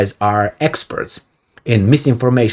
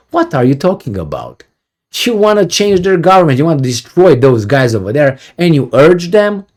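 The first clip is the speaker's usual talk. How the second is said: imagined confrontation; 6 semitones higher than usual. Retold or imitated. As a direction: demand it of the spokesman, outraged. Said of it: "nobody like me to ask him".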